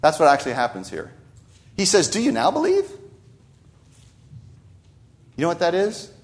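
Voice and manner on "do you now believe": ironic question. Acted. Preacher voicing Jesus to the disciples, ironic high question. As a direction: ask it with pitch climbing high, "Do you now believe?" as gentle irony. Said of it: "That's irony".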